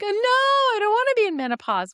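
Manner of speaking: high, fast whiny wail, hammed-up; mock crying patient. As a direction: play a patient pretending to sob at the news. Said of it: "people who start crying".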